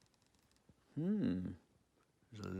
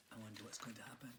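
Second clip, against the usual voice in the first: fast, hushed whisper; whispered wonder; 12 dB quieter, much faster than usual. Acted. Narrator wonders to the children in a quick whisper. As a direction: tease what comes next under the breath.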